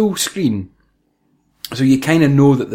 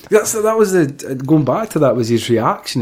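ordinary talk, nothing marked

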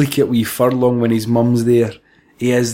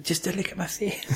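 hushed whisper, much softer; whispered aside; 5 semitones higher, 13 dB quieter than usual. Pretend. Whispered joke line.

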